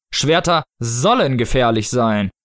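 slow, deliberate statement; Snow Father figure. Acted. Snow Father answering a panicking mother, slow unmoved voice. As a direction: state it ponderously, word by word.

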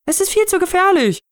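very high, alarmed wail; wailing mother. A narrator's reading. Mother objecting to the sword gift, high wail. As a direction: protest in a high panicky cry. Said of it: "heulte sie".

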